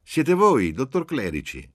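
high, booming, festive hail; loud good-natured bellow, soaring at the start; jovial shout. A narrator's reading. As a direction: shout a hearty greeting down the phone. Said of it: "una grossa voce bonaria e festosa gli gridò".

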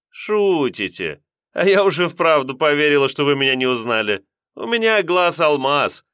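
высокий игривый голос с улыбкой; игриво погрозить пальцем голосом; шаловливо. A narrator's reading. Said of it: "понимающе улыбнулась и шаловливо". (кондукторша игриво уличает милиционера в шутке)

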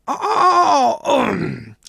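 a high, far-off mock cry that drops away; imitated wail. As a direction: wail like a crowd in distress, then fade. Storyteller voices a wail mid-tale.

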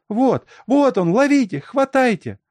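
very high, loud frantic cry; shouting. A narrator's reading. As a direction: shriek for them to seize him. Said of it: "закричала Алёнка". (пронзительно кричит, поднимая тревогу)

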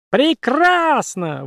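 high, very slow squeaky exclaim; drawn-out puppet cry. Acted. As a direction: stretch it out gleefully. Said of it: "воскликнула кукла".

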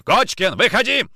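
high, loud angry call; shouted summons. Acted. Nikitin bursting into Kochkin's house, shouted summons. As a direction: call him out at full voice. Officer bursts in calling suspect out.

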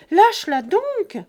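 loud, high, desperate yell; shouting. A narrator's reading. Jean running toward the dragged girl, loud frantic shout. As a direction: roar it while running after her. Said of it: "continuait à crier Jean".

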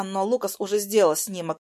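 plain reading voice

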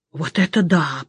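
low, drawled exclamation; lingering, low-pitched marvel; drawn-out amazement. A narrator's reading. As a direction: stretch it out in quiet wonder. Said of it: "протянул сосед".

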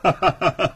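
brief ha-ha-ha in even bursts; short sociable laugh. Acted. Official inviting his old classmate to sit, short laugh. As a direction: laugh it off before speaking.

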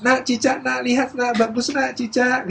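put-on high singsong luring of a child; coaxing helper. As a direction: distract a little child with a high voice. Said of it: "terus lihat cicak". (membujuk anak kecil dengan nada tinggi)

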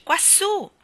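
high, loud call from above. Acted. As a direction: direct her attention upward. Unseen voice calls from above.

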